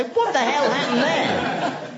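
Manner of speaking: high, slow, baffled voice; startled bear. Retold or imitated. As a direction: play the bear, stunned and confused. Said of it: "as if to say".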